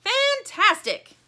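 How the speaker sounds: loud, thrilled character shout; yelling. A narrator's reading. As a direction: blare it out in high excitement. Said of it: "yells the thing in the box".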